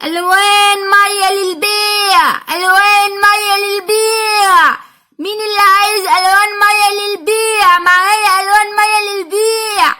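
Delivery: slow, drawn-out sing-song hawker's cry; street vendor's call. Acted. Old vendor woman calling her paints for sale in the dark, sing-song cry. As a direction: call out the wares like a night peddler.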